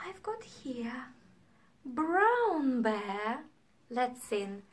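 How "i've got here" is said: hushed excited whisper; quietly, secretly. Acted. Teacher whispering about the woken teddies.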